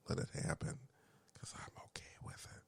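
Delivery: fading into a breathy whisper. Retold or imitated. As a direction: drop to a secret whisper. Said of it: "I'm whispering because".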